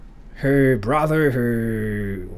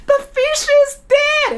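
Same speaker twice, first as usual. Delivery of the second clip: put-on much higher and louder, a little girl's tearful cry; childlike crying wail; 6 dB louder than usual. Girlfriend sobbing that her drawn fish died, high childish wail. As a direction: wail it like a seven-year-old. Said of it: "she sounded super high-pitched".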